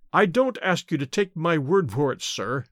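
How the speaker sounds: ordinary delivery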